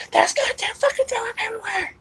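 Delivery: piercingly high imitated scream; screaming dad. Son acting out dad finding dog vomit, screaming. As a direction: screech it like a dad at the top of his lungs. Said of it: "screaming top of his fucking lungs".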